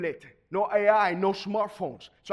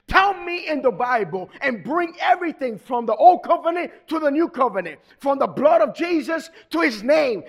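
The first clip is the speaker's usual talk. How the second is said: raised, higher-pitched commanding voice; loud command; 5 semitones higher, 5 dB louder than usual. Acted. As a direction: call out emphatic orders to an AI. Acts out commanding the AI.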